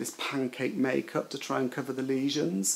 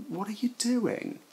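retold disbelief; 10 semitones higher, 4 dB quieter than usual. Retold alarmed question to friend.

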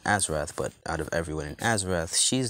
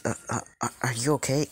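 higher, stammering concern; 6 semitones higher, faster than usual. A narrator's reading. Raven to fallen Deku, concerned and stammering. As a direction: hesitantly check on them, voice raised in pitch. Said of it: "now she's concerned".

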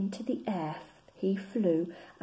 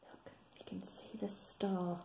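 much softer, unvoiced aside; soft whisper; 13 dB quieter than usual. A narrator's reading. Reader whispers to the children about the star. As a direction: point it out in a hush.

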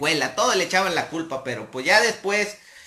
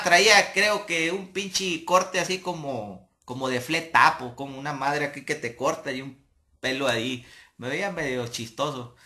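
ordinary talk, nothing marked

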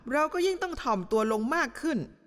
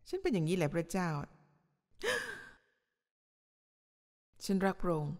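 showy pious prayer; 3 semitones lower, 5 dB quieter than usual. Retold or imitated. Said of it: "คุณกำลังทำให้ใครประทับใจอยู่หรือเปล่าเนี่ย".

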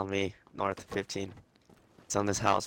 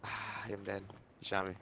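normal talking voice

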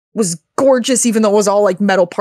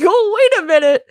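much higher, choked-up catch of surprise; as if moved to tears at the reveal; sudden realization; 10 semitones higher than usual. Retold or imitated. Player realising Emil is back.

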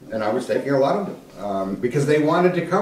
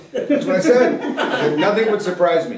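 laughing; 7 semitones higher, 4 dB louder than usual. Real, not acted. Laughing while recalling his remark.

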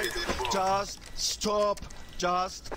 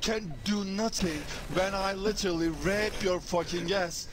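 ordinary delivery

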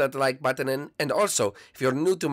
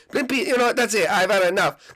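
high, loud exasperated outburst; fed up; 5 semitones higher, 4 dB louder than usual. Acted. Luigi losing patience with Blimpy, high and loud. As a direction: blow up at the pest.

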